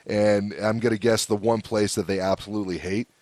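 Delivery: ordinary talk, nothing marked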